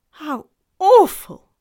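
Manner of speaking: high, loud, drawn-out dismay; horrified child. A narrator's reading. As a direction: exclaim slowly in shocked sympathy.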